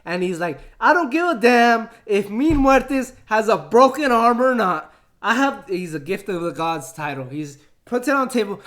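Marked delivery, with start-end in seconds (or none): 0.8-5.6 loud defiant challenge; angry demand. Retold or imitated. Champion yelling that he wants his title shot. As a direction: bark out the demand like a furious champion.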